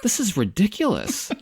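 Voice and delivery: mock disbelief, rising high by the end; lightly acted astonishment; imitating amazed mom. Host quotes mother-in-law seeing moose, mock amazement. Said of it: "Christy's mom is like".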